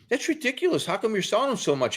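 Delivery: high-pitched, retold complaint; incredulous protest. Customer protesting to bike shop guy, incredulous. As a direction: re-enact balking at the price.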